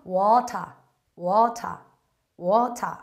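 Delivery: British water with a crisp t and open ah ending; British accent water. Shown as a demonstration. Teacher contrasting British water for students. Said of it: "영국이나 호주 발음은".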